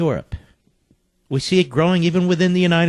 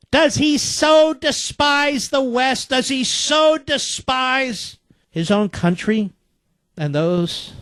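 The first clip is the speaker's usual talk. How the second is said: high, slow, drawn-out outrage; real rhetorical outrage, slow and raised; indignant oratory; 8 semitones higher, slower than usual. Real, not acted. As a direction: stretch out the indignant question, pitched high. Indignant rhetorical question about the President.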